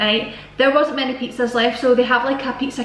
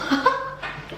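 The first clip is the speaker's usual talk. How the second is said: high breathy giggle; 14 semitones higher, 6 dB quieter than usual. Real, not acted. Laugh over spring roll.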